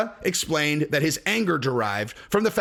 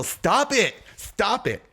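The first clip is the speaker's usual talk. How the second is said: whiny tickled voice; 5 semitones higher, much slower than usual. Retold or imitated. Elmo being tickled.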